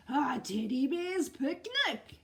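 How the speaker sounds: loud bear bellow. Acted. The bear finding the picnic, loud bellow. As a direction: boom it out. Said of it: "he bellowed".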